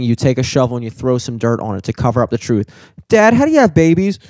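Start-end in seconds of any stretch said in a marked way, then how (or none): 3.1-4.2 put-on high-pitched, louder kid question; mock child's voice, pitched up; imitated curious kid. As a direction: pipe up like a child asking dad. Voices a child asking dad.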